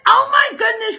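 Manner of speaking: light up at a familiar name; excited greeting. Real, not acted. Greeting David from Zondervan on the livestream, delighted and loud.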